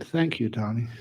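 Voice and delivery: wife softly grateful; gentle thanks. Acted.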